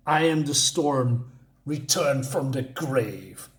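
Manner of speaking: slow, portentous declaration; lightly acted epic proclamation, unhurried; grandiose Thor. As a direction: draw the words out like an over-the-top hero. Reads Thor's over-the-top answer.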